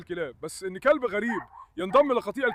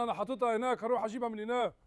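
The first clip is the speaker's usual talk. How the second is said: ordinary delivery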